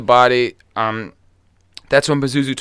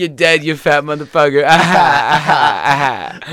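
creepy Southern villain; 4 dB louder, slower than usual. Retold or imitated. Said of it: "He's like".

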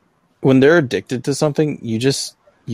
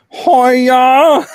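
high, louder mock karate cry; a feeble martial-arts shout, hammed up; weak karate yell; 11 semitones higher, 6 dB louder than usual. Hearing a tinny karate yell from giant speakers, mock karate shout. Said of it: "sounds like the weakest karate chop".

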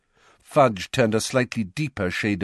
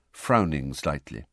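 ordinary delivery

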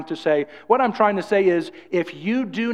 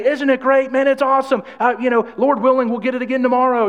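higher, louder, beaming enthusiasm; upbeat enthusiast; 6 semitones higher, 4 dB louder than usual. Retold or imitated. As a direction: sell the sunny day like it's a gift. Voicing an upbeat positive person.

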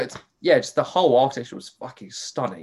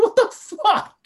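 laughing disbelief; 5 dB louder, much slower than usual. Retold or imitated. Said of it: "I was like".

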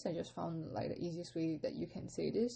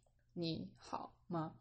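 very staccato, each syllable set apart; 5 dB quieter, slower than usual. Shown as a demonstration. Teacher showing learners her careful reading, very staccato. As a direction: break 你好吗 into separate, detached syllables. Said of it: "I'll be reading, like, very staccato".

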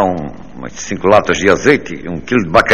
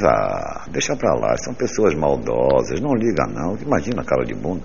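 1 wife gently consoling; 5 semitones lower, 5 dB quieter than usual. Retold or imitated.

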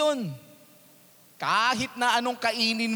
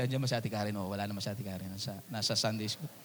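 hushed aside; 9 semitones lower, 9 dB quieter, faster than usual. Real, not acted. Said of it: "checks if wife is present".

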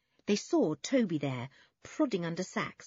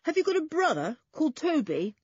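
high, louder questioning call; 11 semitones higher, 5 dB louder than usual. A narrator's reading. Julian asks the boy about Toby, calling out. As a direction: keep calling across the yard. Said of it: "called Julian".

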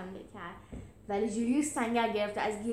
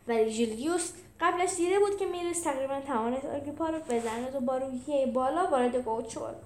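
plain reading voice

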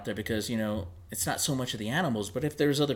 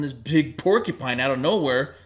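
ordinary talk

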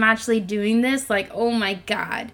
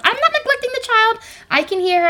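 defensive; 11 semitones higher than usual. Real, not acted. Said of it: "she thinks I'm neglecting the child".

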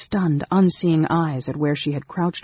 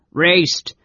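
louder, higher shout; booming call; 4 semitones higher, 6 dB louder than usual. A narrator's reading. Warrior hollers up to his brother, booming. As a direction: call out as if from below. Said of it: "Caramon boomed from below".

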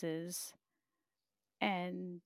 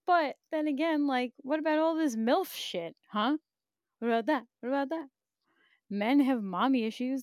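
heated argument; 5 semitones higher, 5 dB louder than usual. Real, not acted. Arguing a point heatedly.

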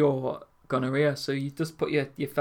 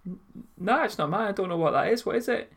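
higher, nervous mock innocence; playing dumb to his mum, pitched up; flustered denial; 7 semitones higher than usual. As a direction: fake not knowing what it is. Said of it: "And I've gone".

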